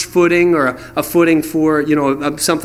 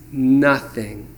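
glum answer; 6 semitones lower than usual. Retold or imitated. Disciples answering the stranger, low and glum. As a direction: drop it flat like a defeated fisherman. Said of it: "disciples answer glumly".

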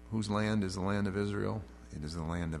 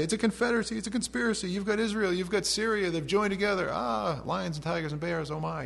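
much higher, hammed-up panicky voice; frantic mock alarm, pitched way up; 12 semitones higher, 3 dB louder than usual. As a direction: squeal it like a frightened crowd. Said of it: "These people are freaking out".

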